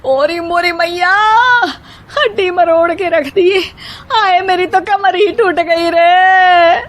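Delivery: put-on high, crying old woman's wail; wailing in pain. Mother-in-law slips on the wet floor and wails in pain. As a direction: moan after a hard fall.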